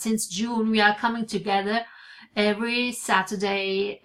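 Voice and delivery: usual speaking voice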